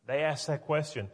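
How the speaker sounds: normal talking voice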